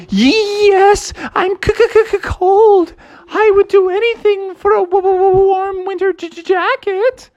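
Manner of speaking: very high voice, stuttering through chattering teeth; shivering frog. Acted. Froggy tells Billy Goat Gruff he wants a jacket, high shivering stutter. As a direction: stammer every hard sound like a freezing little frog.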